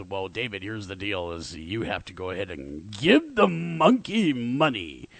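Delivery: a hint of a stern spokesman; mock PETA spokesman. PETA voiced again, lower.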